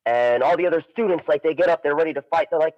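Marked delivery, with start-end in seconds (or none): none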